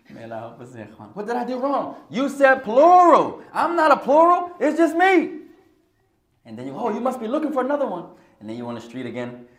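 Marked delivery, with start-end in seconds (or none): 1.1-5.4 high, loud, shrill-edged outburst as the wife; mock jealous wife. Mock jealous wife scolding husband. 6.8-8.1 mock suspicious wife. Wife's suspicious quip imitated.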